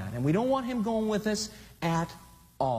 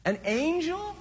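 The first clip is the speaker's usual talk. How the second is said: incredulous; 11 semitones higher, slower than usual. Acted. Mocking Achish's praise.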